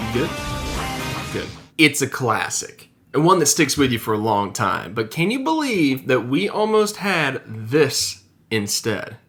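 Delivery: upbeat host read; video narration. Acted. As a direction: read it like a show intro. Scripted video intro read.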